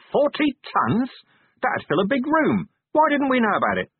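high-pitched, incredulous questions; acted astonished outburst. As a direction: react in shocked disbelief, pitch shooting up. Vimes astonished at the figure.

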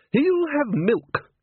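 put-on firm, high, slow old woman. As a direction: lay down the law, slowly. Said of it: "said Granny firmly".

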